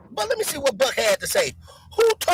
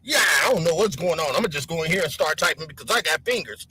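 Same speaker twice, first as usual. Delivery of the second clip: much lower, quick dopey voice; mock clueless commenter; 11 semitones lower, faster than usual. Mocking chat user Buck typing nonsense, low fast dopey voice. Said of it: "You come up here".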